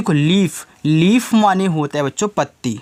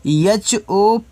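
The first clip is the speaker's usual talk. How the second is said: slow spelling out; 3 semitones higher, much slower than usual. Shown as a demonstration. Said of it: "जैसे आपने इसको रोज पढ़ा था".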